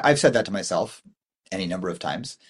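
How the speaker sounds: ordinary delivery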